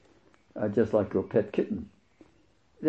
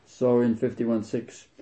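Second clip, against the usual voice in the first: normal talking voice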